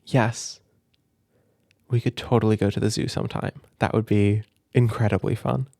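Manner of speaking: ordinary talk, nothing marked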